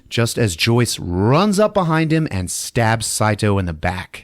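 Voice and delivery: ordinary delivery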